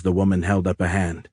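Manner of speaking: ordinary delivery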